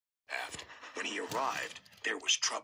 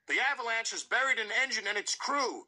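high, raised alarmed shout; 9 semitones higher, 3 dB louder than usual. A narrator's reading. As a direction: shout the news to the miners. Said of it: "shouted the foreman".

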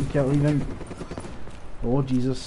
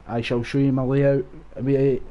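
normal talking voice

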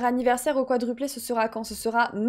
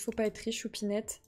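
soothing; 3 semitones lower, 10 dB quieter than usual. Acted. Comforting sad Sim softly.

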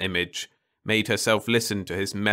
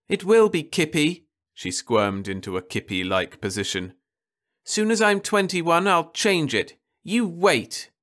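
put-on defiant girl; 8 semitones higher than usual. A narrator's reading. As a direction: dare her mother to stop her. Daughter defiant.

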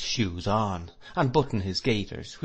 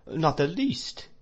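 slow, high denial, rising at the end; pitched-up, drawn-out disbelief; astonished denial; 7 semitones higher, slower than usual. A narrator's reading. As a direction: draw it out in surprise. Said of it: "with astonishment".

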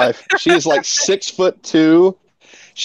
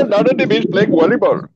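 higher, hammed-up Indian accent; 5 semitones higher than usual. Joking about missing V's, mock Indian accent. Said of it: "an Indian guy say".

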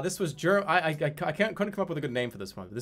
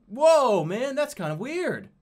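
mock unbothered awe; 9 semitones higher, 4 dB louder, slower than usual. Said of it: "It's like".

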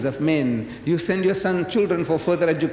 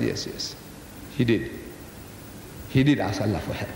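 low, softened acted concession; reluctant admission; 7 semitones lower, 6 dB quieter than usual. Cornered Maulana admits it to the Reverend, reluctantly.

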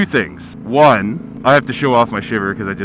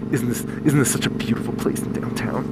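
hushed whispered come-on; seductive whisper; 6 dB quieter than usual. Pretend. A man luring a date at the lookout, breathy whisper. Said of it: "Take 'em up to the view and go".

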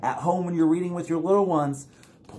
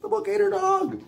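dramatic alarm. Acted. Storyteller shocked that the book ate the dog. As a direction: gasp it out high for the kids.

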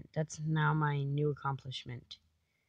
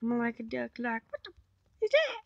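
shrill rising squeak on "is that?"; mock duck squawk; squeaky duck voice; 7 semitones higher than usual. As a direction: jump way up like a startled duck. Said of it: "I keep on repeating that".

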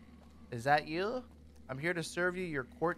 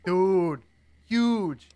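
amazed; 3 semitones higher, 9 dB louder than usual. Real, not acted. Amazed at the bed.

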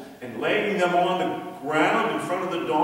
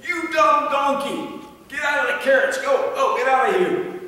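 angry owner yelling; 11 semitones higher, 5 dB louder than usual. Acted. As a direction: chase the donkey off the carrots. Angry owner chasing donkey out.

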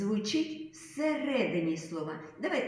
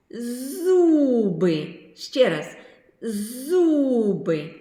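зуби called out louder, z stretched; loud model word; 3 semitones higher, 11 dB louder, much slower than usual. Shown as a demonstration. Teacher calling out words for children to repeat, loud. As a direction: sound out зуби boldly.

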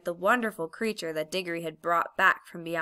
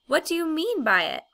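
indignant; 9 semitones higher, 4 dB louder than usual. A narrator's reading. Polly struggles with the witch.

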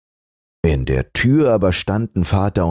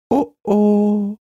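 high, sorrowful sigh; mourning the lame grandmother; sad oh. Acted. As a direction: scraps grieving sadly. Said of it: "sagten die Flicken traurig".

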